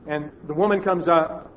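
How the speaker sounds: ordinary talk, nothing marked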